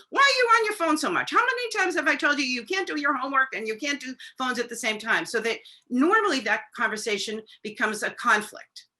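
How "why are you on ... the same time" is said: mock nagging parent; exasperated nagging parent. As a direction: snap at the teen about the phone. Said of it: "as opposed to".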